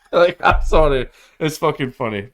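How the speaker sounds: can't help laughing at it; amused apology, half laughing. Real, not acted. Laughs at the dark joke.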